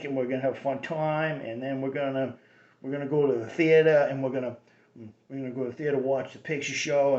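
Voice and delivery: Cambridge Kennedy-style Boston accent, Harvard said without r; a light Harvard Cambridge accent. Shown as a demonstration. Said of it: "which is the Kennedy accent".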